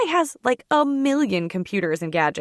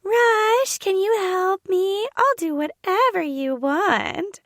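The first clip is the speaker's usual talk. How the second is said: very high, slow simpering mimicry; mock a lovestruck plea in a drawn-out squeak; high-pitched, ridiculous; 11 semitones higher, slower than usual. A narrator's reading. Sister mimicking a plea to Rush, ridiculous high voice. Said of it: "Her voice is high-pitched and ridiculous".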